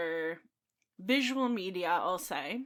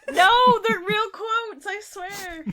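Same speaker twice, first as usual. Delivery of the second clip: laughing; 12 semitones higher, 8 dB louder, slower than usual. Real, not acted. Laughing while defending her quotes.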